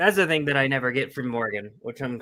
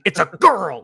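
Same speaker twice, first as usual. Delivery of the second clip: mock angry insist; 3 semitones higher, 8 dB louder than usual. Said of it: "she's pissed off at him".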